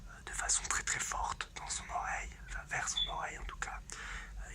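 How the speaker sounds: conspiratorial whisper to the audience; loud stage whisper. Acted. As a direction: whisper it all, unvoiced but pushed. Secret tip to audience.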